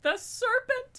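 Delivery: high, loud squeal of delight; overcome squeal. Real, not acted. Fan reacting to the necklace gift, overcome squeal. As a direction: squeal it, melting over the detail.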